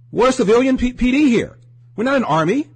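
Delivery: high, loud protest; loud insistent cop. Retold or imitated. Cops telling the public they are civilians, loud and insistent. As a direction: push it hard, almost shouting.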